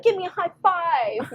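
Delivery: put-on high, eager little-boy voice; a child asking for a high five; high child's request; excited little son. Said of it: "he'll be like".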